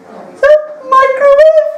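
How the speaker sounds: put-on very high, slow child's voice; squeaky kid. Kids answering the teacher in a high squeak. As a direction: pipe it up like an eager kid.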